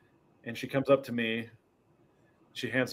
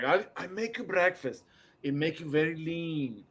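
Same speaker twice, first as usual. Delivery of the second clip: lightly imitated Spanish accent, starting higher; 5 semitones higher than usual. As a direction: mimic the fitness lady's Spanish accent. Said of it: "in a Spanish accent".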